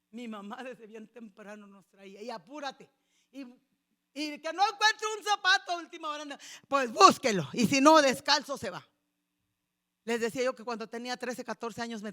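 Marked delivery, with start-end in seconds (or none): 2.2-8.8 loud impatient mom; scolding mother. Retold or imitated. Mother loudly rushing her children to church. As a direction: hurry the kids along sharply.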